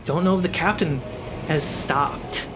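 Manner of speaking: ordinary talk, nothing marked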